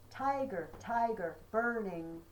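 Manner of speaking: Tiger, tiger, burning bright, strong first syllables, slow and loud; slow chant with loud first beats; trochaic stress. Shown as a demonstration. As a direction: pound out each trochee. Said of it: "that only has three".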